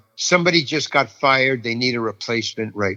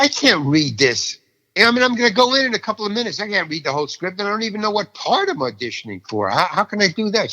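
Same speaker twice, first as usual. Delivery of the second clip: higher-pitched, retold complaint; exasperated protest; 7 semitones higher than usual. Actor complains to receptionist about the script, exasperated. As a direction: protest in mounting disbelief.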